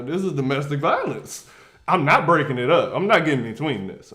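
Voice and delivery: usual speaking voice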